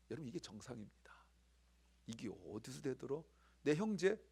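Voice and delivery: usual speaking voice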